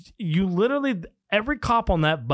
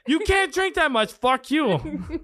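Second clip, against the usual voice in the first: high, mocking cop retort; quoting the cops, high and mocking; 11 semitones higher, slower than usual. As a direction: pitch it up to mock the cop.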